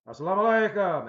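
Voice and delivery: loud, high, forceful salaam; authoritative greeting. Acted. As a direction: boom the greeting down. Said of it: "and it frightened Abraham".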